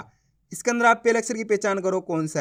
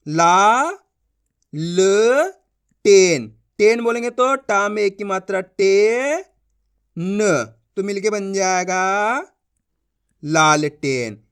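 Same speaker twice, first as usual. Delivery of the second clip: slow spelled-out reading; 5 dB louder, much slower than usual. Shown as a demonstration. Spelling out lantern syllables.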